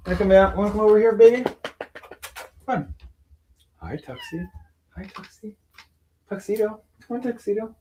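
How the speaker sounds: high sing-song coaxing voice. Real, not acted. Calling the cats Lola and Tuxie over, high baby talk. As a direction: coo to the cat to coax him out.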